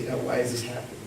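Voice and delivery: soft, trusting plea; open-armed plea. Shown as a demonstration. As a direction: ask gently, arms open to God. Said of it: "look to heaven with arms wide open".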